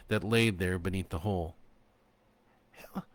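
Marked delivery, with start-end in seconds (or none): 2.7-3.0 raspy and parched. A narrator's reading. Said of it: "Her voice was raspy and parched".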